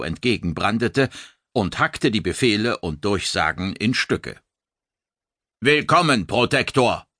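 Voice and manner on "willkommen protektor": rasping loudspeaker. A narrator's reading. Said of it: "schnarrte irgendwo in seiner Nähe einer der unsichtbaren energetischen".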